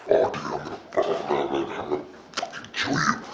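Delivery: low, hushed murderous threat; Harry whispering his threat to Bellatrix; menacing whisper. Retold or imitated. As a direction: whisper the threat right in her face.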